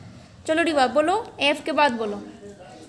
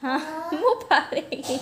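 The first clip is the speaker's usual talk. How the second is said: laughing; 6 dB quieter, slower than usual. Real, not acted. Teacher laughs at child.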